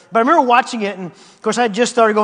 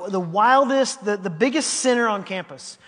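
ordinary talk

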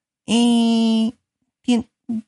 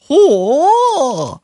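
much higher, louder startled exclamation; shocked gasp; 8 semitones higher, 6 dB louder than usual. Acted. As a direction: jolt of shock. Crowd shocked by the news.

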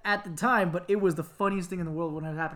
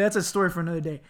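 ordinary talk

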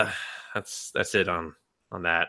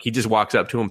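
ordinary talk

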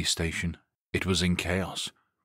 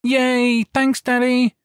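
put-on much higher and louder delighted child's cheer; excited little girl; 8 dB louder, much slower than usual. A narrator's reading. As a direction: squeal with joy like a happy little girl. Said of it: "Samantha cried".

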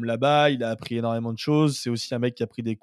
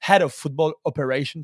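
English pronunciation; 3 semitones higher, 6 dB louder than usual. Real, not acted. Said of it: "T'as travaillé ton anglais cet été?".

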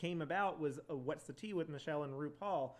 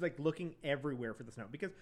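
ordinary talk, nothing marked

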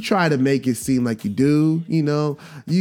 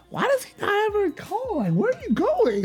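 put-on much higher, pleading child voice; whiny kid. Young son pestering mom about the stepdad, high and whiny. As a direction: play the nagging preteen asking questions. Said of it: "I'd be like".

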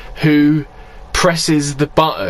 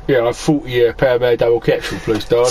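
put-on Cockney accent; 5 semitones lower than usual. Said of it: "a Cockney accent".

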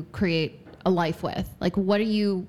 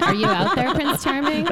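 higher, playful call to an imagined prince; mock calling out; 8 semitones higher, 4 dB louder than usual. Single woman jokingly calls out for her Prince Charming, playful mock summons. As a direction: call out as if searching for someone, half joking.

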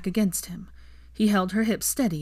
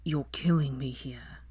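slow, strained whisper; groaned whisper; 4 semitones lower, 5 dB quieter than usual. A narrator's reading. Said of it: "he groaned".

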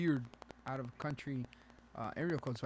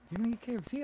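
high, incredulous question; mock disbelief; 9 semitones higher, 4 dB louder, faster than usual. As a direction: act scandalized by the choice. Voices a shocked friend.

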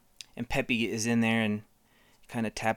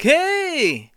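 high, loud, goofy shout; 9 dB louder than usual. Retold or imitated. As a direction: yell it like a cartoon guy. Mimics Pixar actor shouting.

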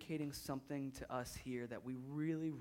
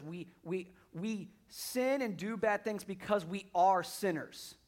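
preaching at full force; 5 semitones higher, 9 dB louder than usual. Acted. Pastor pressing the congregation, preaching loudly. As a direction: hammer home that we are sinners. Emphatic sermon climax.

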